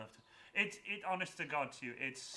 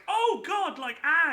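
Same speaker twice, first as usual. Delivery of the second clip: high, loud imitation of a frightened shriek; retold scream; 14 semitones higher, 12 dB louder, slower than usual. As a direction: mimic her startled scream, much higher and louder. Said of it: "she just screamed".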